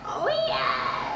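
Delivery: very high, soft mock squeal; squeaky delighted yes. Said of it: "I was just like".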